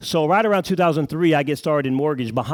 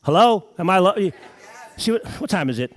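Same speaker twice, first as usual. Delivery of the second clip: ordinary delivery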